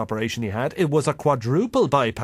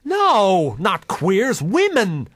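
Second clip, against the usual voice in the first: emphatic; 5 semitones higher, 4 dB louder, slower than usual. Real, not acted. Correcting mother emphatically.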